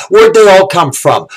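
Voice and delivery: normal talking voice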